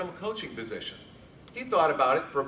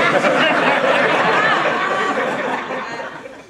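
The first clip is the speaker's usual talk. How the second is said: high, loud hearty laughter; big laugh; 12 dB louder than usual. Real, not acted. Speaker laughing at own cheer, big laugh. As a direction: let a big laugh roll out.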